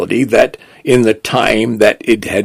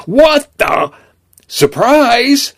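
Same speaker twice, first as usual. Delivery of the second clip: startled cry; 14 semitones higher, much slower than usual. Acted.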